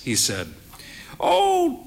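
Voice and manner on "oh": put-on higher, slow voice of a proud frail old lady; frail old mother. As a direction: play the bird-faced old mother, cautious. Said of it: "she said".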